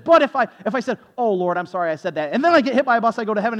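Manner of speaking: worked-up mock disbelief; retold incredulous challenge. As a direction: push the absurd case at her. Acting his incredulous challenge to her.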